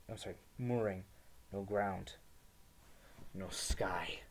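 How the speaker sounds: ordinary talk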